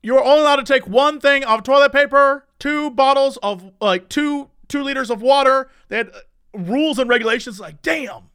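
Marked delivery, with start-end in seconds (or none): 0.0-5.7 high, loud announcer imitation; store announcement. Store announcing limits to shoppers, high and loud imitation. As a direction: call it out like a loudspeaker notice. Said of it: "They had announcements going that were like".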